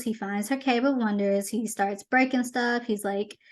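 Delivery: normal talking voice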